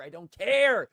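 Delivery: shouting frustration; exasperated shout. Real, not acted. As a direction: yell it out, fed up. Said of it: "I just don't care".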